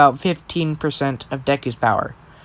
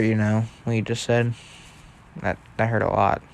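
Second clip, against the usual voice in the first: softly wounded, low and hushed; quiet hurt; 4 semitones lower, 7 dB quieter than usual. Acted. Deku to his brother after the insult, quiet and hurt. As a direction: let the hurt sink the voice down. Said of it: "he looks very sad".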